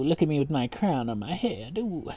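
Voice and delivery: ordinary talk, nothing marked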